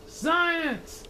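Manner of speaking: high, sharp royal bark; barked command. Acted. Yertle silencing Mac, high sharp bark. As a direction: cut him off hard. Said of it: "barked back".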